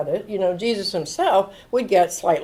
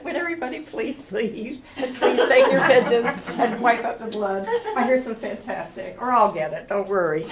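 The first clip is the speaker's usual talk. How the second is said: high, soft sugary voice with laughs; polite mock Jesus, smiling sweetly; 3 semitones higher, 4 dB quieter than usual. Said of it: "smiling sweetly".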